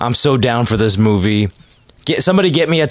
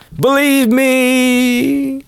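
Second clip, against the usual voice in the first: high drawn-out voice; 12 semitones higher, much slower than usual. Pretend. Mock solemn aside.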